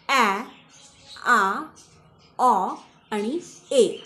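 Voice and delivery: A said four ways: ae, aa, aw, ay; each vowel sound of A set apart by pauses; sounds of letter A. Shown as a demonstration. Teacher showing learners the different sounds of vowel A, demonstrating. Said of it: "असे वेगवेगळे उच्चार".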